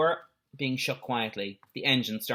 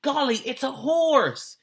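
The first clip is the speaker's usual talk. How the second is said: amazed gasp; 13 semitones higher, 4 dB louder, slower than usual. A narrator's reading. Boys find hidden horse.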